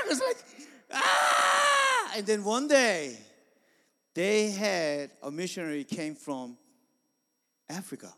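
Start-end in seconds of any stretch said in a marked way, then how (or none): 0.9-2.1 high, loud imitation yell; deacon's shout. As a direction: cry out like the excited deacon. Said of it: "he starts to shout".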